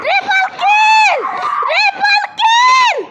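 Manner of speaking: slow, high, drawn-out announcer shout; esports caster. Pretend. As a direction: hype it like a game announcer. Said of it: "announcing triple kill like a caster". (ধারাভাষ্যকারের মতো টেনে চিৎকার)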